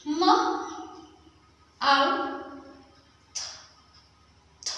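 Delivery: माउथ split as म, आउ, थ, थ; sounding out. Shown as a demonstration. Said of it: "pronounce कैसे करेंगे?".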